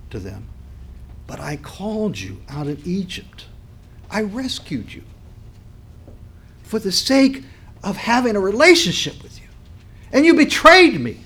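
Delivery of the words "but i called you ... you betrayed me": hurt voice of God. Retold or imitated. God reminding unfaithful Israel, hurt building to anguish. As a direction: rise from a hurt murmur to an anguished outcry. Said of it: "you can hear that in God's voice".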